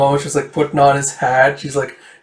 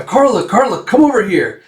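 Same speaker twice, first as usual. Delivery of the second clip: raised, higher call across the yard; 6 semitones higher, 4 dB louder than usual. Retold or imitated. Villagers calling Karluk over, loud and high.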